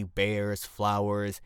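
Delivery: usual speaking voice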